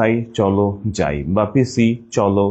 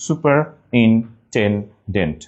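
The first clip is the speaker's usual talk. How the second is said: slow "superintendent": super, in, ten, dent; broken into syllables; much slower than usual. Shown as a demonstration. Teacher making spelling clear, "superintendent" slowly in pieces. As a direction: pause between each piece of "superintendent". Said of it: "ভেঙে নিলে clear হয়ে যাবে".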